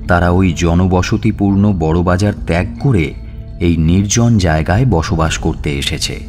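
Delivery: usual speaking voice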